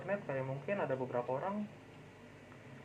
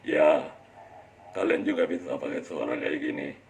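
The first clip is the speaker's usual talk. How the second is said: much lower, loud monster growl; 12 semitones lower, 7 dB louder than usual. Shown as a demonstration. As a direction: drop into a deep monster voice. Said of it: "kayak sosok monster atau apa".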